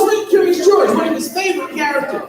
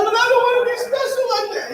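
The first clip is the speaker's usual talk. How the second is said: put-on whiny crying kid; 8 semitones higher than usual. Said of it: "But he's like".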